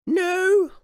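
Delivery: very high, loud curt queen. Acted. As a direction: clip it like a grand old queen. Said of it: "replied the queen".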